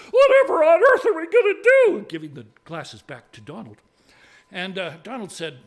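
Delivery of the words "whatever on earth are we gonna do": very high, loud lady in dismay; panicked wail. Acted. Lady asks the tinker for help against goblins, loud panic. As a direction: wail the question in hammed-up panic.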